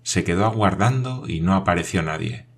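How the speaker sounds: plain reading voice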